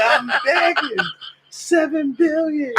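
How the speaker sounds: high, very slow wail; wailing stranger. Retold or imitated. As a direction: drag it out like a pleading beggar. S1 voicing the beggar.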